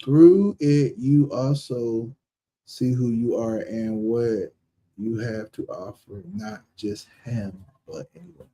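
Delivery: reading real slow. Real, not acted. Reader working through a message about what you offer, read real slow. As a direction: pick through the words slowly. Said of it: "I had to read that real slow".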